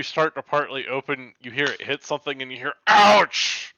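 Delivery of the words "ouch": high loud orc ouch; orc yelp. Acted. GM voices orc hit by door.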